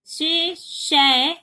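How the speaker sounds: "शि, शै" stretched very slowly to show the difference; drawn out. Shown as a demonstration. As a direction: draw out "शि, शै" so the vowels stand apart. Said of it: "एकदम साफ फर्क दिखाई दे". ("शि, शै" बहुत धीरे खींचकर)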